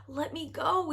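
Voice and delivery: high, pleading cry for mercy; frightened cry. A narrator's reading. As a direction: beg in terror. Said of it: "he cried".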